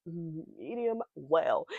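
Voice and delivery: slow, drawn-out posh-sounding medium well; fancy accent. Shown as a demonstration. Ordering steak at the restaurant, in a fancy accent. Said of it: "you gotta say in a fancy accent".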